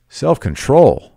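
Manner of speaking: high, loud, drawn-out disbelief; incredulous. A narrator's reading. Tom repeats his wife's request for self-control, incredulous. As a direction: throw it back slowly, scandalised. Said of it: "repeated Tom incredulously".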